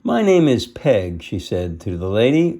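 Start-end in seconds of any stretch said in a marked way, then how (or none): 0.0-1.2 high, loud sassy introduction. A narrator's reading. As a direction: introduce yourself with sass. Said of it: "sassy-looking female".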